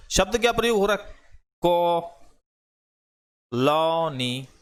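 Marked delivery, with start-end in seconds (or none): 1.6-4.5 कॉलोनी with the o stretched long and open, between o and a; कॉलोनी, vowel held very long and open; drawn-out half o. Shown as a demonstration. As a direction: slowly draw out the ओ in कॉलोनी like half an आ. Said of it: "मतलब आधा आ".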